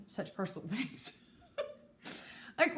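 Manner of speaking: ordinary talk, nothing marked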